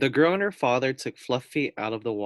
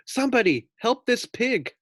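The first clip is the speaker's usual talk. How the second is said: much higher, louder plea; desperate plea; 12 semitones higher, 4 dB louder than usual. A narrator's reading. Pig pleading for rescue, high and desperate. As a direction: beg for help.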